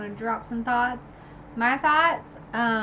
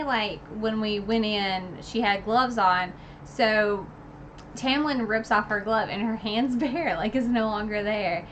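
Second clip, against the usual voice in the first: ordinary delivery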